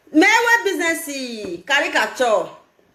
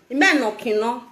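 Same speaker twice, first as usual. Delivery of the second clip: normal talking voice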